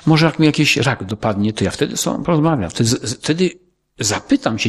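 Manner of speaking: normal talking voice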